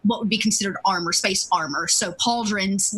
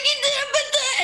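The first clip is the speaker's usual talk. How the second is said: put-on whiny kid. Street kid whines his innocence to the armored adjudicator. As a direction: play the innocent brat, singing it out. Kid voiced pointing at Malik.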